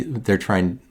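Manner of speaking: ordinary talk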